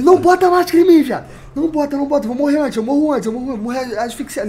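high, loud terrified pleading; panicked scream. Retold or imitated. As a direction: scream as if suffocating. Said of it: "eu comecei a gritar". (gritos agudos de pânico)

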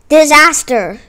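punchy cry of bad news; dramatic exclamation. A narrator's reading. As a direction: hit it hard as a sudden calamity. Dramatic turn in the story.

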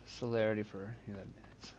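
reading the tooltip in a whisper; hushed muttered read-out. Real, not acted. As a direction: whisper it to yourself while reading. Reading an item tooltip aloud.

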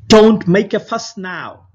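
loud firm scolding; stern old bird. A narrator's reading. Wise old bird scolding the flock, stern. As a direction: silence the panic with authority. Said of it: "said the wise old bird".

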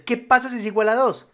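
normal talking voice

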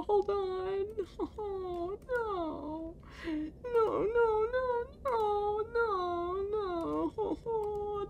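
high, soft whimpering wail; despairing whine. Real, not acted. Gamer mourning lost progress, soft high despairing whine. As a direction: whimper it high and quiet, drawn out.

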